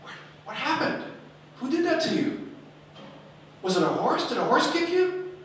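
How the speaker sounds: put-on high, loud, curious kids' questions; eager children. Pupils begging the teacher about her scar, high and loud. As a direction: blurt it out like wide-eyed pupils.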